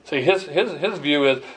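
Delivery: normal talking voice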